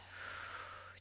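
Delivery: soft, airy exhale; breath out through mouth. Shown as a demonstration. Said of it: "out through the mouth".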